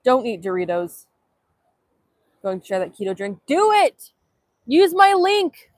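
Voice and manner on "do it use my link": high, insistent command; hammed-up urging. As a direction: urge viewers with mock intensity.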